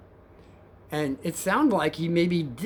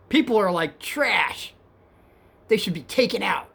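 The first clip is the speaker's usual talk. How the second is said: hostile passenger; 4 semitones higher, 3 dB louder than usual. Retold or imitated. Rider ranting at the cab driver, hostile.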